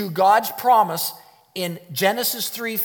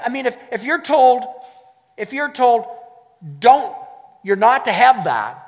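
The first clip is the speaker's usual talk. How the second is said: loud; 6 semitones higher, 6 dB louder than usual. Acted. Preacher acting out inner voice.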